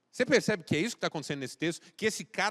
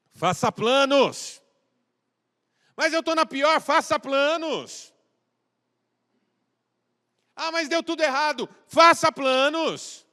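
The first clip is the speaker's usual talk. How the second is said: full force preaching; 10 semitones higher, 10 dB louder than usual. Acted. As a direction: drive the message home to the congregation.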